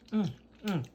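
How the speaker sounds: mm through licked fingers; savoring while licking fingers. Real, not acted. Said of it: "Sorry, I'm licking my fingers".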